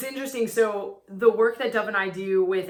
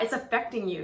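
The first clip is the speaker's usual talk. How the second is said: ordinary talk, nothing marked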